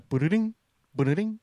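high, loud ba-da-ding ringtone; imitated text-message chime. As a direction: imitate a phone alert by mouth. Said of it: "text message theme song".

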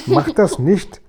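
high, whining protest; pained howl. A narrator's reading. Guri protesting at his hurting ear, pained wail. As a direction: wail it in complaint. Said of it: "heulte der Guri".

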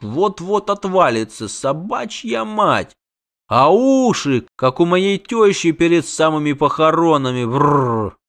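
slow, high drawn-out revulsion; disgusted. A narrator's reading. Halfling gawks at his sagging double, disgusted. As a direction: stretch the words in appalled disgust.

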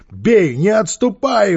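loud, high, slow battle cry; rally the gang with a shout; shouted command. A narrator's reading. Gang leader urging boys to fight, shouted command. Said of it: "крикнул Квакин".

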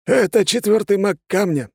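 proud. A narrator's reading. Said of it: "с гордостью заявил Людвиг".

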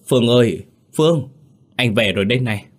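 urgent calling for someone; gọi giật giọng. A narrator's reading. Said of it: "Phong gọi giật giọng". (gọi to, giật giọng)

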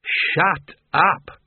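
emphatic, punched rebuke; two separate, forceful words; in unison. A narrator's reading. Wizards all silencing the bursar at once, emphatic rebuke. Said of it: "said the wizards in unison".

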